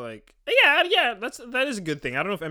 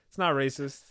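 ordinary talk

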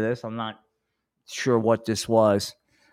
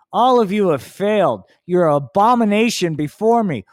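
higher, louder Jesus preaching doom; booming, preachy stoner Jesus; 5 semitones higher, 3 dB louder than usual. Acted. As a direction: scold the unbelievers from on high. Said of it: "Jesus lecturing stoner".

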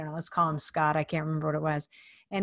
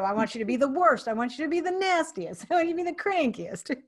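rising, playful escalation ending near laughter; playful goading; 8 semitones higher than usual. Retold or imitated. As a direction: pitch climbing with each demand. Goading a man to role-play.

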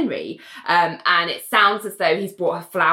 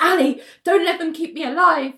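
high, urgent retold plea; acted alarm, pitched well up; panicked; 10 semitones higher than usual. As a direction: imitate someone waking in a panic. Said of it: "is all panicked as soon as she wakes".

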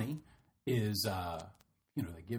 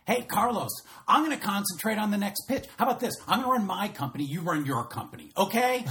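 higher and louder, heated pushback; snapping back in a mock argument; retold angry retort; 7 semitones higher, 5 dB louder than usual. Arguing back at Carlos.

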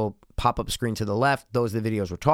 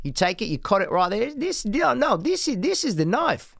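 high, hammed-up Aussie knife bit; mock Australian showing off a knife; Australian accent; 7 semitones higher than usual. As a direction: Crocodile Dundee style, pitched high. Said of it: "Australian's pretty easy".

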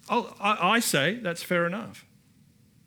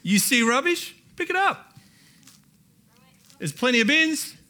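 playful challenge; 9 semitones higher, 5 dB louder than usual. Real, not acted. Challenge to congregation.

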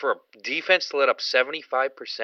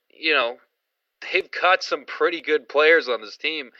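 normal talking voice

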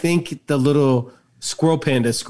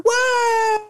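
very high squeak; squeaky panda. Retold or imitated. Panda leaving the box, squeaky what. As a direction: squeak it like a small animal. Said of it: "I think he goes".

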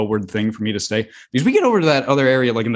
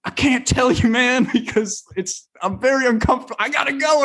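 scared; 13 semitones higher than usual. Retold or imitated. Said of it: "I'm literally, like, scared".

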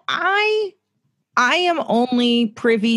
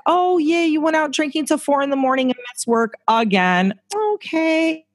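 higher, mock-casual acceptance; resigned; 9 semitones higher than usual. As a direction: shrug it off, letting it go. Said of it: "it's like".

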